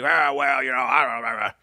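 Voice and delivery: put-on old-man grumbling; comic old man lost for a comeback; crotchety old man. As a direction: fumble it like a crotchety old-timer. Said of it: "I'll be a crotchety old man".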